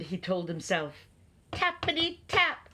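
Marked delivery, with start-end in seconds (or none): 1.5-2.7 high, crisp knock sound words; sudden knocking. A narrator's reading. Narrator voicing the knock at Owl's door, high tapping.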